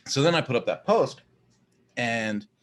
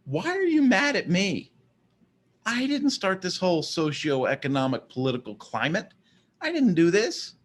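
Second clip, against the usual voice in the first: higher, louder, bewildered pushback; exasperated protest; 7 semitones higher, 4 dB louder than usual. Retold or imitated. Answering an angry commenter in his head, exasperated and higher. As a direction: throw up your hands, why me.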